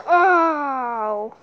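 usual speaking voice

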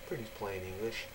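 ordinary delivery

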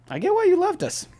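very high squeaky voice; comic squeaked confession. Acted. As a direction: squeak it out as a punchline. Acted punchline confession to dad.

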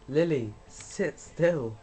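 slow, loud scold; firm telling-off to a pet; scolding the cat. Real, not acted. Scolding the cat mid-game.

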